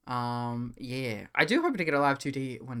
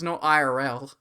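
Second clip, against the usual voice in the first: usual speaking voice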